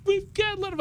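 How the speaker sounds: very high imitation of a distraught wife; pleading wife. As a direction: plead in a squeaky mock wife voice. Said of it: "And my wife's like".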